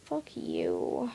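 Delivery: low, soft grumble under the breath; sulky low grumble; grumbled. A narrator's reading.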